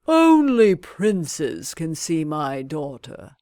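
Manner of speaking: slow, lordly sultan voice. A narrator's reading. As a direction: speak down from the throne, slowly. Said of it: "the Sultan said".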